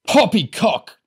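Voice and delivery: high, loud indignant bellow. A narrator's reading. Big drunk man rejecting the warning, bellowing. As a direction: bellow it dismissively. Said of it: "Large bellowed".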